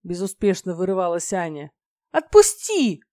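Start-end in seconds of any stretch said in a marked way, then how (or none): 2.1-3.0 high, loud angry yell; fuming demand. A narrator's reading. Dragoness puffing fire as she struggles in his grip, fuming shout. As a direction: cry out to be released, fuming. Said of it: "пыхтела она огнём".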